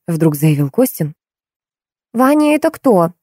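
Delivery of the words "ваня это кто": high-pitched puzzled surprise; amazed question. A narrator's reading. Girl interrupting the major's joke, amazed. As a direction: ask in mild astonishment, voice lifted. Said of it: "изумилась Лиза".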